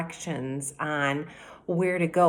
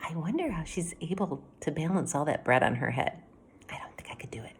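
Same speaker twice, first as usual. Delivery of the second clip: whisper; 6 dB quieter, faster than usual. Real, not acted. Teacher's quiet aside to children.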